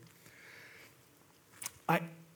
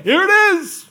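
high, loud showing-off; showy proud reveal; 13 semitones higher, 11 dB louder than usual. Pretend. Mock builder showing off the buildings, much higher and louder. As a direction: present it like a grand unveiling. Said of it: "You're like".

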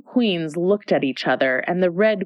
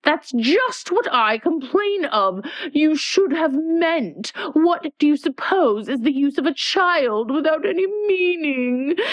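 impatient Red Queen; 8 semitones higher than usual. A narrator's reading. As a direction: lecture the child sharply. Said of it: "interrupted her impatiently".

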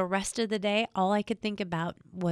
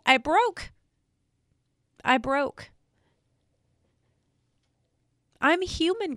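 voice breaking; 4 semitones higher than usual. Real, not acted. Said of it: "I'm sorry that I cried".

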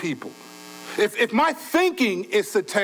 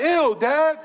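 high mock cringe; grossed-out kid; 9 semitones higher, 4 dB louder than usual. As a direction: imitate a kid recoiling from dad's remark. Mimics kids grossed out by dad.

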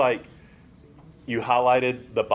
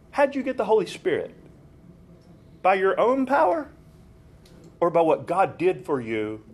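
forceful questioning; 6 semitones higher than usual. Acted. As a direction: push the question hard at them. Reading Paul's forceful rebuke.

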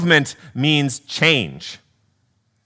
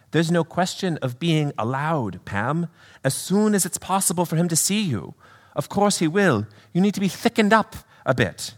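softer British spirit voice; British accent; 4 semitones higher, 4 dB quieter than usual. Acted. Brother reassuring his sister, soft British accent. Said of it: "do a little accent work".

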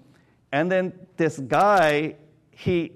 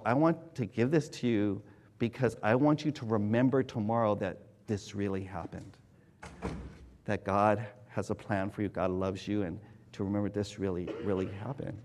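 softer, lower, tender assurance; earnest blessing; 8 semitones lower, 8 dB quieter than usual. Retold or imitated. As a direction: speak like a quiet benediction.

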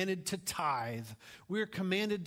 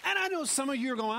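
high, loud, impassioned preaching; preaching at full force; 10 semitones higher, 6 dB louder, faster than usual. Acted. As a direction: exhort the congregation with fire.

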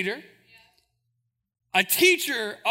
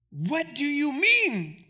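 surprised exclaim; 3 semitones higher, much slower than usual. Acted. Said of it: "exclaimed Nicodemus".

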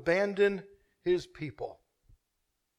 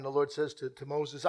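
normal talking voice

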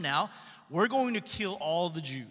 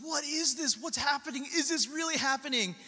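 panicked; 6 semitones higher than usual. Acted. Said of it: "They're like".

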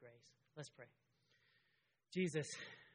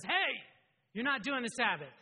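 higher, louder mock call of reproach; 6 semitones higher, 11 dB louder than usual. To a Sabbath-skipper, a loud mock call-out. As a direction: call out the sinner loudly.

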